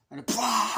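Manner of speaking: loud high bah burst; hammed-up jump-out noise; mock attack bark. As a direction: hit it like a sudden ambush. Imitating Michael's attack.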